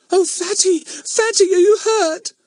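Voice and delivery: put-on very high, loud little-girl wail; frightened child in tears; tearful. A narrator's reading. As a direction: cry out in tearful worry. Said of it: "said Bets in tears".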